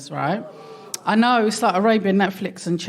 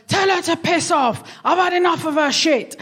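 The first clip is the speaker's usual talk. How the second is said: high, indignant retort; re-enacted pissed-off outburst; 8 semitones higher than usual. Daughter venting to mum about sister, re-enacted high-pitched anger. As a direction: act out losing patience with your sister. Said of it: "I got pissed off".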